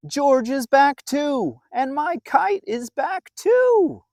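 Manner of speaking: put-on high, loud happy child voice; excited child. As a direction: bubble over as the delighted boy. Bill rejoicing at George's return.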